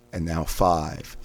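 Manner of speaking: normal talking voice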